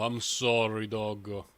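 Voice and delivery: ordinary talk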